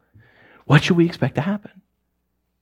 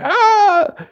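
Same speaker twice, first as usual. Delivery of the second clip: laughing cry. Real, not acted. Laughing at own bit.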